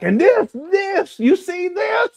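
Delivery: hammed-up hysterical woman; mocking the lady. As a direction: imitate her frantic showing off. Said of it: "she was like".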